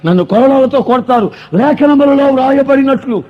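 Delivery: loud, pounding sermon delivery; full-force preaching. Acted. Pastor telling the congregation of Christ's suffering, full-force preaching. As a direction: preach it to the back rows.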